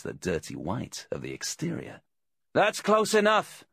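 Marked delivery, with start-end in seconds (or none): 2.5-3.6 high, loud order to keep back; commanding. A narrator's reading. Voice from inside the caravan halts the visitor, commanding. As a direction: call out sharply, high and forceful. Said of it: "came the commanding voice from inside".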